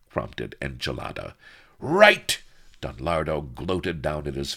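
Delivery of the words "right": high, loud burst; exclaiming villain. A narrator's reading. Don Lardo takes his henchman's prompt, exclaiming. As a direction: burst out loudly on one word.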